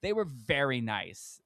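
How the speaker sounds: ordinary delivery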